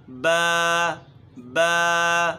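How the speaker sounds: با high and loud from the true point; correct ba. Shown as a demonstration. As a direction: model the right با. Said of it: "اصل مخرج با کا". (با اصل مخرج سے)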